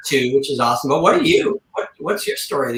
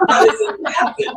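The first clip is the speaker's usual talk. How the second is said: squeaky laughing question. Real, not acted. Laughing at lube job.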